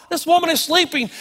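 ordinary delivery

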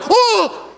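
sudden high yelp of pain; a yelp from the kid after the trombone mouthpiece hit his teeth. Retold or imitated. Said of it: "He goes".

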